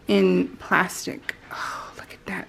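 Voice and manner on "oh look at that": very soft awed whisper. Real, not acted. Pointing out the trim to viewers, whispered. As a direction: barely breathe it.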